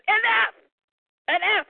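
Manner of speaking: high sharp "Enough!" at the table; mock shout. Father silencing fighting sons at dinner, mock shout. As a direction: cut the boys off with a shout.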